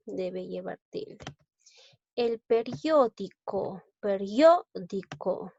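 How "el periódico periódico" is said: periódico said slowly, the syllable rio stressed. Shown as a demonstration. Teacher models periódico for students, slowly with rio stressed. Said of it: "mayor fuerza de voz en la sílaba rio".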